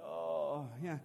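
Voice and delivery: too worn out to match their joy; weary. Retold or imitated. Tired dad answering his excited kids, weary. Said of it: "Sometimes you're like".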